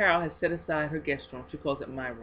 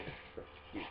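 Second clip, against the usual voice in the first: whispered; 20 dB quieter, much faster than usual. Real, not acted. Whispering over gift.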